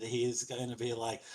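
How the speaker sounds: ordinary delivery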